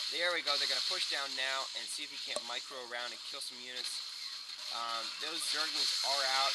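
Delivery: loud, urgent game commentary; live play-by-play. Real, not acted. Caster calls a Roach assault on the hatchery, loud play-by-play. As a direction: call the battle at full volume as it breaks out.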